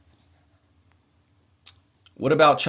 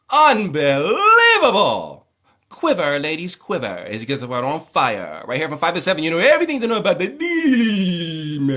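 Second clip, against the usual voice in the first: Chris Tucker as radio host; 5 semitones higher than usual. Retold or imitated. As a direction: shriek it like a flamboyant showbiz DJ. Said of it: "I loved Chris Tucker".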